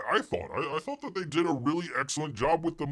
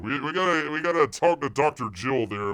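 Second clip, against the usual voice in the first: ordinary delivery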